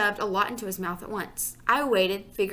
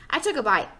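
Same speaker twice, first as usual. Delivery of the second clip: usual speaking voice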